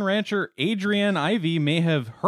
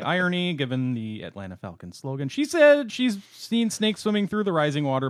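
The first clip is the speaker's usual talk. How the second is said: normal talking voice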